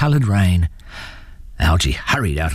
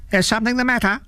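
high possum voice calling out; much faster than usual. A narrator's reading. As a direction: call down from a tree, pitched high. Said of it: "a voice called out from above".

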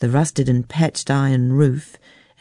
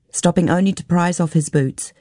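ordinary delivery, nothing marked

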